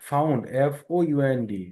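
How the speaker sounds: usual speaking voice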